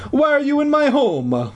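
high-pitched stage-Italian demand; hoarse Italian accent. A narrator's reading. Janitor confronting intruders in the basement, hammed-up Italian accent. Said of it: "his hoarse Italian voice".

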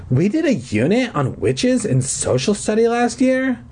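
put-on high, strained sick-girl voice; hoarse voice. A narrator's reading. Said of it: "she said in her hoarse voice".

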